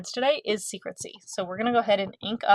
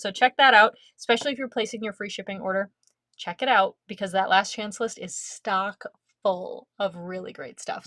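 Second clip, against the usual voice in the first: ordinary talk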